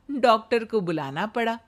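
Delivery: plain reading voice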